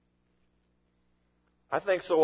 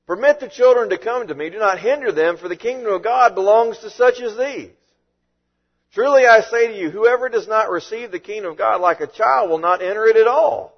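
louder, higher, indignant command; 6 semitones higher, 7 dB louder than usual. Retold or imitated. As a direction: rebuke the disciples with full force. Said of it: "He was indignant and said to them".

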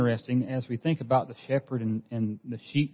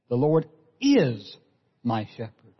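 "is" lifted and held, very slow; slow, with all the weight on "is"; stress on IS; slower than usual. Shown as a demonstration. Preacher dwelling on each word for the congregation, stressing "is". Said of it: "emphasizing each one of those words".

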